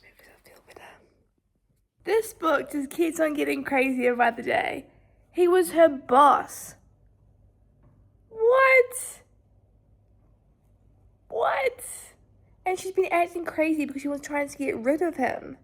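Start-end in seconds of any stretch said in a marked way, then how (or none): 2.1-6.8 full-voice loud rant about the book; loud reaction. Real, not acted. Loud voiced reaction after whispering. 8.3-9.2 loud high shocked "What?". Real, not acted. Shocked at plot twist. 11.3-15.6 full-voice loud rant about the book; loud reaction. Real, not acted. Loud voiced reaction after whispering.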